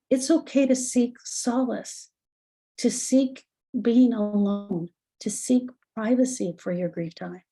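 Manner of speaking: ordinary talk, nothing marked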